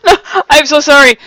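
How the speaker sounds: loud, high, helpless apology; laughing apology. Real, not acted. Host apologizing to a teased player, cracking up. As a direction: apologize through real laughter.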